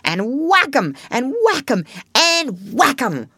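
carried away with battle glee; ecstatic cry. Acted. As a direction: punch each whack with delight. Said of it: "cried the Toad in ecstasy".

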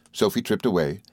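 ordinary delivery, nothing marked

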